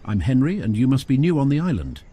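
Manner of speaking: a lightly voiced kind man hailing a newcomer below; Henry. Henry calls down from tower.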